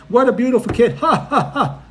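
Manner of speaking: politician's fake laugh. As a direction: ham up a politician's phony chuckle. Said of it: "many politicians will do that, the social laughter".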